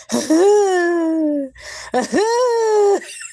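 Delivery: put-on high squealing baby laugh; imitated high baby giggle; imitating baby laugh. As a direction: mimic the odd baby laugh. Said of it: "he'd be like".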